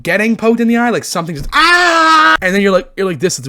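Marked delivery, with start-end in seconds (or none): 1.5-2.4 sudden high loud cry; pained yell. Retold or imitated. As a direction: yell like you got poked. Imitating eye-poke pain.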